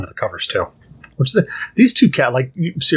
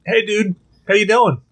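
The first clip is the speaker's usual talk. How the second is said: higher casual cat voice; a light cat voice, higher; voicing the cat; 8 semitones higher than usual. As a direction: greet like a chill cat. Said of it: "He's like".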